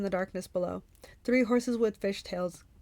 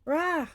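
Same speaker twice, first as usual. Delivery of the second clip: short high-pitched roar; narrator's mock monster growl-cry; 8 semitones higher than usual. A narrator's reading. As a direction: roar out like an enraged Cyclops. Cyclops roars before hurling boulder.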